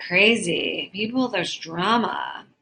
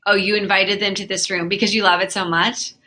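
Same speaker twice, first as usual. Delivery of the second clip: ordinary talk, nothing marked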